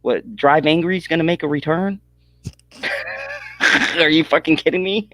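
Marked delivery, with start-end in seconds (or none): 2.8-3.9 squealing high laugh. Real, not acted. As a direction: let out a shrill giggle. Laughing at Drive Angry.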